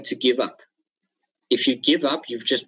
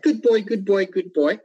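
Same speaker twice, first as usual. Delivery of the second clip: gushing praise; 9 semitones higher than usual. Retold or imitated. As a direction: fuss over the dog on coming home. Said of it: "the dog's getting all excited".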